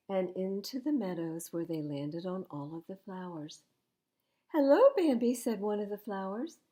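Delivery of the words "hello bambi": higher, much louder sing-song hello; sweet greeting. Acted. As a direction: call out cheerfully from hiding. A flower greets Bambi.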